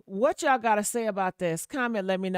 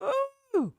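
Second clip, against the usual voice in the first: high delighted squeal of ooh; excited ooh. Real, not acted. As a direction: squeal it with glee. Excited about idea of man.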